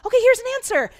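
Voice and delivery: high, loud, eager reply; enthusiastic God. Retold or imitated. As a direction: answer like a pumped-up coach. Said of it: "super enthusiastic".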